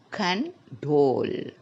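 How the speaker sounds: "Condole" slowly, con-DOLE; stress on last syllable. Shown as a demonstration. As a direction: split "Condole" and hold DOLE. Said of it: "is in the last syllable".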